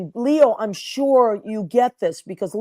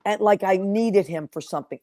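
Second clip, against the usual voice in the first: ordinary talk, nothing marked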